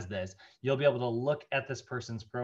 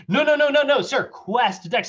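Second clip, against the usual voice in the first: much higher, louder desperate correction; flustered telemarketer; 12 semitones higher, 10 dB louder than usual. Retold or imitated. Telemarketer frantically corrects the dad, in a high, loud pleading voice. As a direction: scramble to correct him. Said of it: "She's like".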